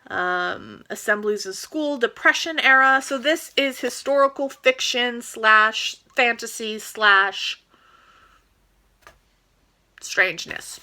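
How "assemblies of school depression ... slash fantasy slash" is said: normal talking voice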